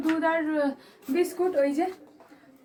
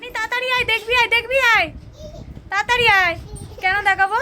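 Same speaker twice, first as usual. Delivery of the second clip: excited calling out; 6 semitones higher, 7 dB louder, faster than usual. Real, not acted. Calling someone over.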